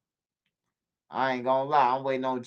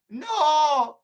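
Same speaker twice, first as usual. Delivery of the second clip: driver's refusal; 11 semitones higher, 4 dB louder than usual. Retold or imitated.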